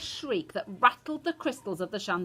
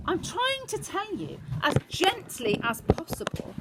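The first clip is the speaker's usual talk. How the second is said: strained patience; 11 semitones higher, slower than usual. A narrator's reading. Grandmother breaking bad news gently.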